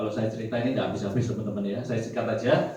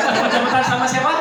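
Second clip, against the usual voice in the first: high, louder laughing question; 11 semitones higher, 8 dB louder, faster than usual. Real, not acted. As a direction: ask through laughter. Laughing while asking. (bertanya sambil tertawa)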